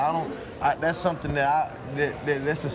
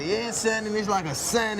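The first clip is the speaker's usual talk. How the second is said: lightly mimicked Louisiana accent, pitched up; imitated Louisiana accent; 5 semitones higher than usual. Player mimics his teammate's Louisiana talk, lightly imitated accent. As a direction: tease with a touch of Louisiana talk. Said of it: "It's the accent is like a".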